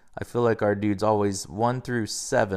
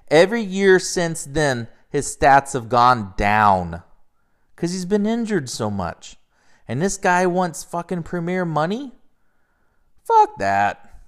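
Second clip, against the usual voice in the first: louder, slower, higher, leaning on every word; fed-up rant; 6 semitones higher, 7 dB louder, slower than usual. Real, not acted. Fan ranting about a player's salary, angry and emphatic. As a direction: vent the frustration, building to a blunt curse.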